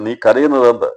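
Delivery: high, loud pointed question; ഉച്ചത്തിലുള്ള ചോദ്യം. Acted. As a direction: press the question loudly on കരയുന്നത്. Jesus asks Mary again. (കരയുന്നത് എന്ന വാക്കിൽ ഊന്നി ഉച്ചത്തിൽ)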